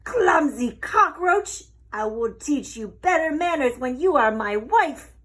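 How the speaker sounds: loud, high angry squawk; furious rooster. A narrator's reading. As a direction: scold her like an outraged rooster. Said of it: "the rooster was furious".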